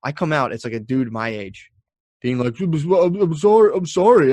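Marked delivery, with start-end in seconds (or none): 2.6-4.3 high, loud, stammered mock apology; panicked apology. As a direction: imitate someone frantically backing down. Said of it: "he just apologized".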